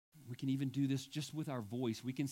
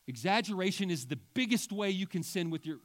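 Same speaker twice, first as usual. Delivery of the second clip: louder, higher, overblown claim; playfully overstate it with big emphasis; hyped-up preacher's overstatement; mock exaggeration; 5 semitones higher, 7 dB louder than usual. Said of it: "I'm just kidding, I'm exaggerating".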